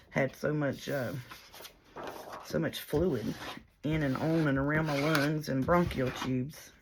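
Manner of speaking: normal talking voice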